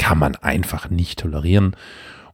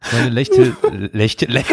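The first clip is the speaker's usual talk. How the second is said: stifled giggles; 3 semitones higher than usual. Real, not acted. Cracking up at listener letter.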